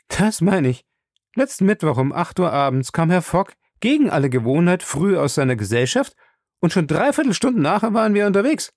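talkative servant, pitch climbing high; chatty Passepartout. Acted. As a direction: tell the odd story eagerly. Voices Passepartout recounting the departure.

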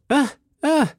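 two very high exclamations; surprised cry. A narrator's reading. D'Artagnan learning he faces his landlord, high surprised cry. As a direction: cry out high in sudden recognition. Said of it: "rief D'Artagnan".

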